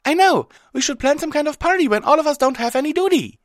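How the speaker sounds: very high, fast, loud squeal; squeaky, excited pony voice; brightening up. A narrator's reading. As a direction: burst out with a giddy idea. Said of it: "for a moment before brightening up".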